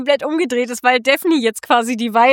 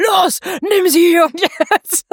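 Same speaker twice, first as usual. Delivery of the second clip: urgent inner voice; 6 semitones higher, much slower than usual. Retold or imitated. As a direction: bellow the command like a pushy inner voice. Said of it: "Und die innere Stimme".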